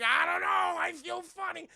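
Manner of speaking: high, loud bewildered wail; mock panic. Elisa feeling magic take hold, acted panic. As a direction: cry out in hammed-up alarm.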